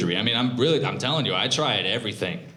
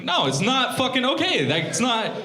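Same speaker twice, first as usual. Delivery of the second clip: outraged retort; 14 semitones higher than usual. Retold or imitated. Comic arguing with bartender.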